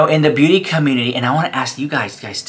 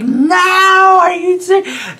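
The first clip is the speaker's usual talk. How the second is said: high-pitched squeal of disbelief; reenacted scream, pitch shooting way up; retold scream; slower than usual. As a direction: shriek it high, acting out the shock. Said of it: "I was like, I screamed".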